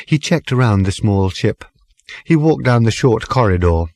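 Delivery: ordinary delivery, nothing marked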